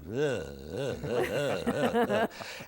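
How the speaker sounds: low laugh like a truck motor on a weak battery; sputtering laugh imitation. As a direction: imitate an engine struggling to start, as a laugh. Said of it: "truck motor trying to start".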